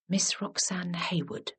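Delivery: hushed unvoiced whisper. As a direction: breathe the name, barely audible. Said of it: "whispered Lily".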